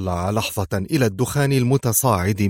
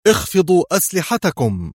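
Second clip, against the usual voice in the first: high, forceful order; stern command; 8 semitones higher than usual. A narrator's reading. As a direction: call out a harsh command. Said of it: "بصوت صارم قاس".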